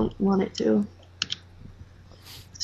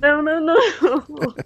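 slow laughing no no no; laughing refusal; 6 semitones higher, much slower than usual. Real, not acted. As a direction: refuse while laughing hard.

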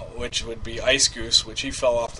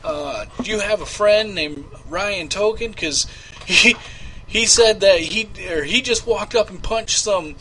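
security guard call; 6 semitones higher, 5 dB louder than usual. Shown as a demonstration. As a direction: fake casino security voice. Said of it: "We could say".

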